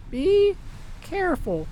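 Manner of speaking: put-on high then soft, a frail old woman's farewell; gentle worried goodbye; tender old Molly. Wife bids husband be careful, high and soft.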